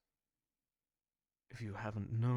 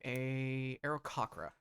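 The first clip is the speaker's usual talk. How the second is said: ordinary talk, nothing marked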